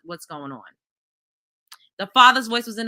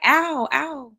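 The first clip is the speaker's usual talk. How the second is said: lightly imitated baby crying ow; 3 semitones higher than usual. Quoting the baby in the video, lightly imitated baby. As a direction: mimic a baby's little ows. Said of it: "the baby's like".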